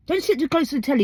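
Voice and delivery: a nagging parent's warning; a mild parental scolding. Retold or imitated. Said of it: "old sayings listed".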